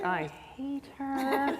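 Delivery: soft, slow deadpan aside; whispered-down snark; snide aside. Retold or imitated. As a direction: quietly drop the mask, half laughing. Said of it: "aside after fake greeting".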